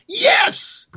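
very high, thin cry of joy; exultant cheer. Acted. Host cheering a plan to abolish the IRS, exultant. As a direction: squeal it like a mock celebration.

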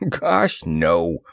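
light chuckle into the words; amused, chuckled denial; chuckling. A narrator's reading. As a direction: laugh off the idea. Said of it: "chuckled Moore".

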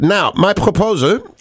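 put-on high, drawn-out German-accented announcement; mock-formal German accent. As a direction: imitate a German listener, slow and deliberate. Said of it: "allowed to do the German accent".

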